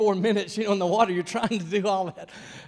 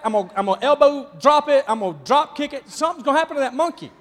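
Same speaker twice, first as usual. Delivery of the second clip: loud, high mock bravado; hammed-up shouting about the monkey; mock threats; 10 semitones higher, 11 dB louder, faster than usual. Joking about attacking the monkey.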